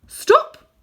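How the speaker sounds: very loud, high shout; shouted stop. A narrator's reading. Witch shouting at Uncle Andrew at the door. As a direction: halt him at the door. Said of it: "said the witch".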